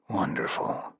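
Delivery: breathless sarcastic aside under the breath; a soft, unvoiced sarcastic aside; dry muttered sarcasm. A narrator's reading. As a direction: mutter it dryly to yourself. Jed's sarcastic muttered thought.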